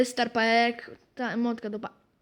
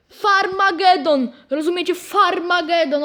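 dramatic announcement; 5 semitones higher, 5 dB louder than usual. Real, not acted. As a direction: proclaim the pun grandly.

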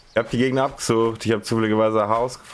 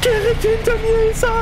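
very high panicked shriek; terrified scream; 4 dB louder than usual. Real, not acted. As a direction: scream as it closes in. Monster right behind. (panischer Schrei)

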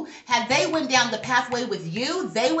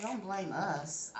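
angels' reply; 3 semitones lower, 11 dB quieter, much slower than usual. Retold or imitated. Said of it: "They said".

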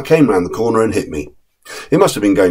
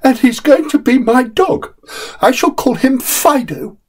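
put-on determined little boy. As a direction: dig in, won't be moved. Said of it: "with a determination which I heartily admired".

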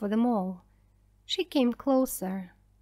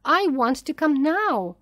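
loud, raised-pitch shouted demand; narrated man's shout; 5 semitones higher, 6 dB louder than usual. A narrator's reading. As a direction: call it out loudly, insisting. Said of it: "shouted James Kelly".

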